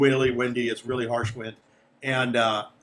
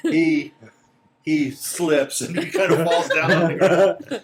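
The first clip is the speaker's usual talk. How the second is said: louder, laughing through the telling; amused laughing narration; 4 semitones higher, 7 dB louder, slower than usual. Real, not acted. Game master telling players of the slip, laughing. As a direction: narrate it while cracking up. Narrator cracks up at the guide's fall.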